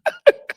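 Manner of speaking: sudden high-pitched laugh. Real, not acted. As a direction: burst out laughing. Laughing at own impression.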